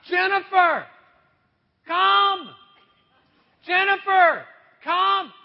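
loud, high call from the shower; yelling for help. Retold or imitated. Pastor stuck in the shower yelling for his wife. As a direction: call her name out, stuck and helpless. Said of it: "so I yell out".

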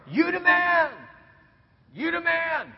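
high mock shout; adoring crowd cheer. All Israel hails Pharaoh, high shouted cheer. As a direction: hail the leader like a cheering crowd. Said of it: "Everyone's going to Pharaoh".

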